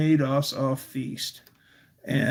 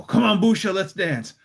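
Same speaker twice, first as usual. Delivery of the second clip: louder, higher, upbeat urging; eager invitation; 5 semitones higher, 4 dB louder than usual. Retold or imitated. Pulling Busha up at Polish Days, eager call. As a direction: coax her out of her chair. Calling grandmother to dance.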